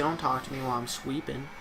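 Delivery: lightly voiced sleepy pet, soft; a hint of baby talk, hushed; voicing the dog. Pet asking not to be disturbed, lightly voiced. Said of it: "You say".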